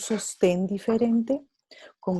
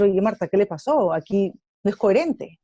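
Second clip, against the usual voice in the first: mild puzzled friend; 6 dB louder than usual. Retold or imitated. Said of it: "Vas a decir".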